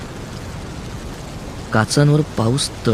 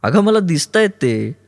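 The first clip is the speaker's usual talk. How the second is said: higher, slow, stretched insistence; insistent yell; 7 semitones higher than usual. A narrator's reading. Aruni insists to Kaumudi that he sees them, yelling. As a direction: insist in a raised voice. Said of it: "Aruni ओरडला".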